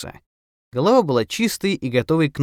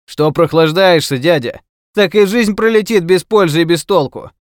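loud, high workman's shout; jaunty loud ribbing, pitched up; cheeky call-out; 7 semitones higher, 8 dB louder than usual. A narrator's reading. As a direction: toss it loudly after a passer-by. Painter calls after the professor jokingly.

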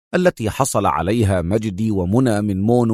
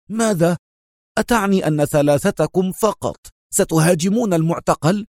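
higher, outraged disbelief; indignant exclamation; 7 semitones higher than usual. A narrator's reading. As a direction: cry out, refusing to believe it. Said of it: "وهو يهتف في استنكار".

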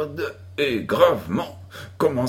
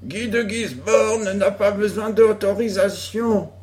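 villain's retort; 8 semitones higher than usual. A narrator's reading. Joe plays Guy de Gisborne.

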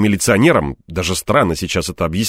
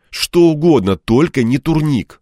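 higher, louder pleading moan; groaning plea; 8 semitones higher, 4 dB louder than usual. A narrator's reading. Man begs for any exercise but that, pleading higher. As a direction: beg in mild despair. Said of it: "простонал я".

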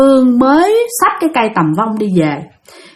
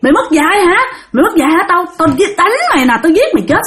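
high-pitched acted threats; mock bellow, raised pitch at ordinary volume; angry hollering; 7 semitones higher, faster than usual. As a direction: play a humiliated husband lashing out. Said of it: "Cho nên ổng mới la làng".

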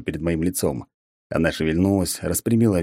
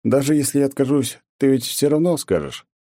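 higher weary teasing; resigned tease; 8 semitones higher than usual. A narrator's reading. Hero anticipates the god's advice, teasing. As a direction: sigh it off, pitched up.

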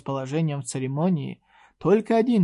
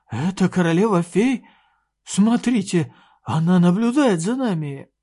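excited; 4 semitones higher, 6 dB louder than usual. Acted. Excited courtier sees fairy queen.

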